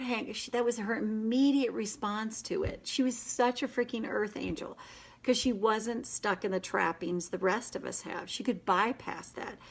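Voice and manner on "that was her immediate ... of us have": ordinary talk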